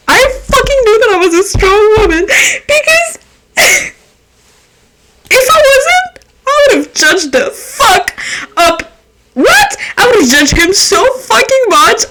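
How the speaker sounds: high laughing rant; giggling mock fury; laughing outrage. Real, not acted. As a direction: laugh through the indignation.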